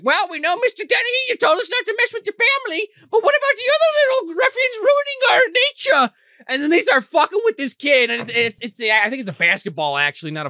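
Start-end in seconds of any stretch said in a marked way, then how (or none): 0.0-6.1 very high squeaky little-folk voice; leprechaun voice. Retold or imitated. Leprechauns warn Mister Dennehy about ruffians, squeaky high. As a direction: pipe it up like a tiny leprechaun. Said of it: "the leprechauns are like".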